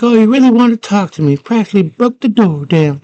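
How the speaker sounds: high, loud, rapid mock outburst; mad sarcastic girl. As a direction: imitate a spoiled girl's sarcastic tantrum. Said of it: "She's all mad".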